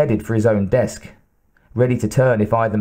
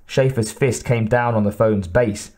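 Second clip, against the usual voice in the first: ordinary delivery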